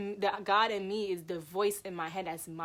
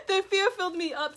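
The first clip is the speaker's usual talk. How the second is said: loud, high, laughing through the words; laughing at how I yelled in fright; 11 semitones higher, 15 dB louder, faster than usual. Real, not acted. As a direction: laugh as you tell it.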